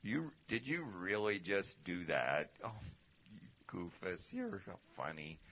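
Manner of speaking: put-on a gentle, bemused God chiding his child; God's fond voice. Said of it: "it's kinda like".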